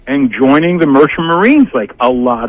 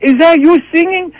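much higher, a lightly voiced puzzled dad; dad asking; 13 semitones higher than usual. The dad asks [name] if he sang, pitched-up puzzled voice. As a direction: play the clueless father, pitched up. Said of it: "my father looks at [name] and goes".

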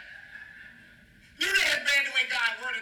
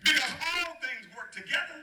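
ordinary delivery

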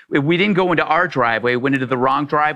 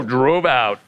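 usual speaking voice

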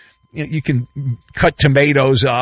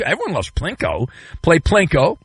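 ordinary talk, nothing marked

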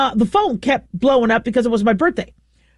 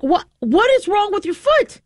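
much higher worried cry; panicked question; 9 semitones higher than usual. Retold or imitated. Owner frantic over dog's foot, high worried cry. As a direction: plead in alarm over the foot. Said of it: "I'm like".

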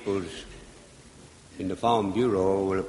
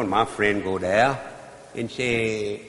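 usual speaking voice

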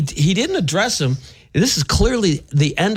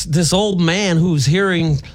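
normal talking voice

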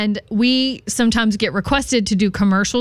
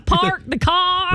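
slow, higher-pitched botched Boston; 6 semitones higher, slower than usual. Shown as a demonstration. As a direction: stretch out a Southern-tinged Boston accent. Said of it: "That was some redneck Boston".

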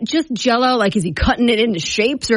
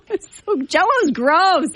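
disgusted; 8 semitones higher, slower than usual. Real, not acted. Disgusted reaction to Jell-O.

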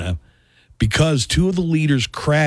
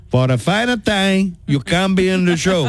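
fifties sitcom voice; 7 semitones higher than usual. Pretend. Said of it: "I Love Lucy".

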